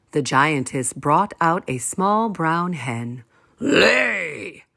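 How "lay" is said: giant's order. A narrator's reading. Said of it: "ordered the giant".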